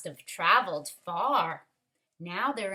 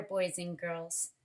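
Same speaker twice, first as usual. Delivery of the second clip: usual speaking voice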